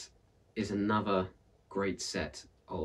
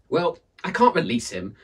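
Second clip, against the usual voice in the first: louder, higher refusal; stubborn; 8 semitones higher, 8 dB louder than usual. Acted. Aunt Misery refusing the villagers, stubborn and loud. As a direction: dig in your heels. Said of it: "she said stubbornly".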